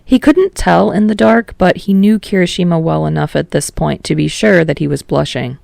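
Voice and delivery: ordinary delivery, nothing marked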